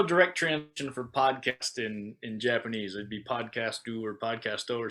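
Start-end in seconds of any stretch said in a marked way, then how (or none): none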